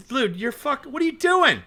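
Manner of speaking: shrill retold shock, voice climbing; disgusted outburst. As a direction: recoil in disgust as you shout it. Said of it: "I started fucking".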